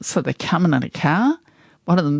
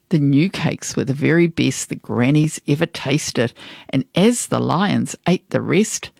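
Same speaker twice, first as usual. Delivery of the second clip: plain reading voice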